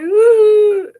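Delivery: high loud whimper of a sob; crying whimper. Retold or imitated. She reenacts breaking into tears. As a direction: let out a sudden crying ooh. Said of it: "First, I was like".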